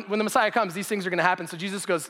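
ordinary talk

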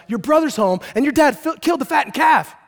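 loud, high announcement from the servant; excited shouted news. Acted. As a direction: call the news out over the party. Servant shouts news to older brother.

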